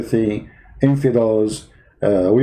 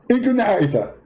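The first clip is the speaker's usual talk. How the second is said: Zakir Naik impression; 6 semitones higher than usual. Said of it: "Zakir Naik?".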